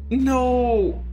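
high, loud shocked yelp of dismay. Real, not acted. Gamer yelps at a bad dialogue pick. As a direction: yelp it out in sudden horror.

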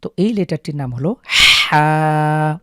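হা slow, pressed from mid-throat; demo of ha. Shown as a demonstration. Tutor introducing the letter হা with throat pressure. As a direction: push হা from the middle of the throat. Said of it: "একটু চাপ পড়বে".

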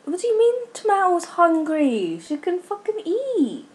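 high, incredulous rant; exasperated outburst. Real, not acted. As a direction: snap back in high-pitched disbelief. Exasperated at hungry game character.

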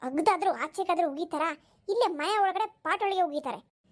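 normal talking voice

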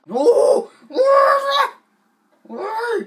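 loud, high startled whoa; startled shout. Real, not acted. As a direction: yelp in surprise. Car tires burst, startled shout.

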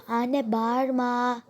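loud, drawn-out exasperated complaint; real exasperated shout. Real, not acted. Child calls to mother to stop yelling, exasperated shout. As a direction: yell it out, stretching the words.

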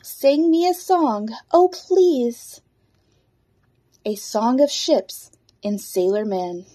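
loud, projected verse reading with pauses; poem recital. Acted. Librarian reading a seashell poem to kids, projected recital. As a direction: recite it out boldly, line by line.

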